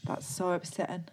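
soft Geordie mimicry; imitated Newcastle accent. Copying the clip just played, soft Geordie imitation. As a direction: repeat it softly in Newcastle style. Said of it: "one more time for you".

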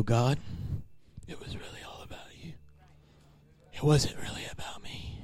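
hushed whisper to God; private prayer. Acted. Believer alone praying after a compliment, whispered. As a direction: whisper it humbly, barely audible. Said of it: "walk in the other room and you go".

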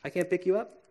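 soft, gently firm parent voice; calm the child as you leave, softly; a hint of a soothing parent; reassuring parent. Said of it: "they have to say".

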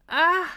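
high, loud startled yelp; startled cry. A narrator's reading. Miffy finds bees in the branches, startled cry. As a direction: cry out in sudden alarm. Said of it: "udbrød hun".

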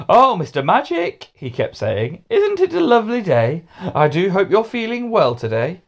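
butter him up, sing-song; sugary nice. Acted. Hamish flattering the teacher at dinner, sugary sweet. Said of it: "being unusually nice to him".

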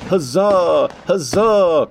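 high, loud court cheer; cheering cry. A narrator's reading. Court cheering the fireworks, loud cry. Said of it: "cried the court".

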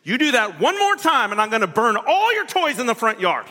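exasperated parent. Retold or imitated. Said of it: "We tell our kids".

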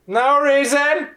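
high and drawn out, brushing them off; slow, high evasive rider. Acted. Rider dodging the party's question, slow and high. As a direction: stretch it slowly, dodging.

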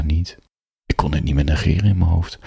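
plain reading voice

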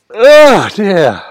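words stretched by a yawn. Shown as a demonstration. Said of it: "see if you can have a proper yawn".

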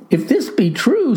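stern mamma. A narrator's reading. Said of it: "says the mamma".

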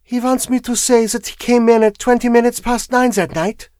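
put-on high, level foreign-accented woman; composed foreign woman, pitched well up; foreign wife. A narrator's reading. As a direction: state it coolly in a high foreign voice. Foreign wife questions the lawyer.

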